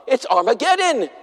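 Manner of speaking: high, urgent, hammed-up alarm; preacher imitating a frantic friend warning of the end times, in mock alarm; mock doomsday panic. Urgent rising prophecy rant, played for laughs.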